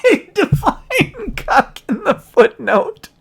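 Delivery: high, loud, slow words through laughter; helpless laughter. Real, not acted. Reader finds a slang term defined in a legal footnote, laughing. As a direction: crack up while saying it.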